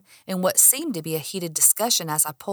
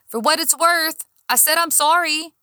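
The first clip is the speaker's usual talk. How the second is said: called out higher and louder; calling after her; 10 semitones higher, 6 dB louder than usual. A narrator's reading. Cop calls apology after departing woman, higher and louder. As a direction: throw it after someone walking away. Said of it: "he called behind me".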